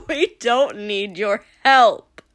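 loud, slow, cackling taunt. A narrator's reading. Villain refusing Shoto's offer of help, cackling. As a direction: crow it through the tail of a laugh. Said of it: "Dabi cackled suddenly".

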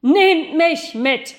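loud, slow, powerful call; strong voice calling up, loud and drawn out. Acted. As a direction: call like from a bronze throat. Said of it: "rief die starke Stimme".

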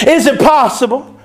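ordinary talk, nothing marked

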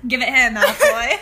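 loud British Harry Potter quote, giggling; British accent. Acted. Co-host quoting Harry Potter as practice, loud British accent. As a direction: bark it out in a put-on British accent.

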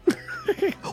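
squeaky high laugh; high giggle. Real, not acted. Laugh after crunk joke.

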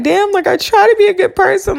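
high, quick retold complaint; high-pitched mock self-pity; mock exasperation. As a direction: protest in a high, hammed-up voice. Acting out her complaint to herself.

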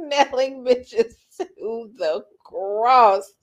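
slow, drawn-out and amused; savoured quote. Retold or imitated. Repeating her term after laughing, slow and savoured. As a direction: relish every word at half speed.

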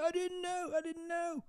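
high mock whiny defence; whiny protest. Parker retold denying he knew her age, high whine. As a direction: whine it like a caught kid. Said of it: "He was like".

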